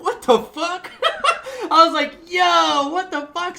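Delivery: high, laughing what the fuck; laughing disbelief. Retold or imitated. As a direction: crack up in disbelief.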